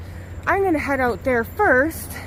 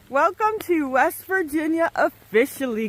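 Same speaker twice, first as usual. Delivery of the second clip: ordinary talk, nothing marked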